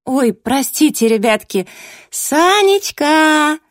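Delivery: put-on loud, high, slow drunken wailing; hollering like a town drunk. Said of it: "пьяным голосом".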